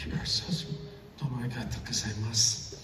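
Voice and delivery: real choked-up thanks, hushed; soft, low thanks through emotion; emotional. Real, not acted. Thanking the audience after getting emotional about the dream, choked up. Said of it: "sorry, I get emotional".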